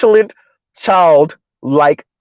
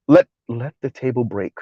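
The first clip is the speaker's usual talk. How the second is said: soft imitation; 7 semitones lower, 6 dB quieter than usual.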